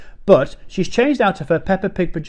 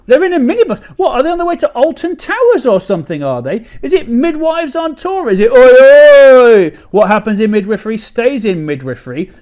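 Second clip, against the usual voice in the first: much higher, louder incredulous rant; mock outrage; 9 semitones higher, 10 dB louder than usual. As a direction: rage at the phone in disbelief. Outraged at minibus news.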